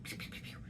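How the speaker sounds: breathy unvoiced pew, pew, pew, pew; whispered gun sounds. Shown as a demonstration. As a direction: mimic tiny gunshots under your breath. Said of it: "that's what he's talking about".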